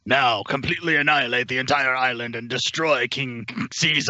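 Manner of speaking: mock-menacing villain giving commands; hammy, menacing movie-villain command; menacing alien commander. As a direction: order destruction like a B-movie overlord. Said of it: "He sounds his most menacing when he gives".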